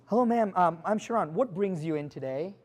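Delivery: soft, hesitant scripted greeting; a timid agent's canned hello; bad greeting example. Shown as a demonstration. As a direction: play the weak rookie opener, softly. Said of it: "Here's what you never do".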